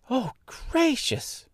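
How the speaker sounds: put-on high, drawn-out girlish exclamation; astonished cry. A narrator's reading. Girl learning she faces a witch, astonished. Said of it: "cried Dorothy".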